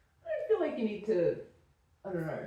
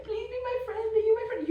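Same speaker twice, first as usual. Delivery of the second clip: high, hammed-up pleading voice; mock the needy princess; mocking whine; 3 dB louder, faster than usual.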